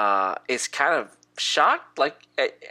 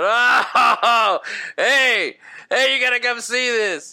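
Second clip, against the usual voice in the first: loud, high, gleeful hollering; cackling orc; 8 semitones higher, 6 dB louder, slower than usual. Acted. Orc calling others to watch the fight, gleeful shout. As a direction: holler to your pals in delight. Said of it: "He's just cackling".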